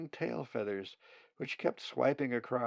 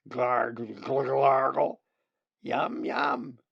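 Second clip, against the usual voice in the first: loud wet gargling gulps, then a smacking yum yum; gulping feeding noises; 3 semitones higher, 9 dB louder, slower than usual. Acted. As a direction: gargle it like a chick swallowing food. Colin feeding chicks, gulping noises.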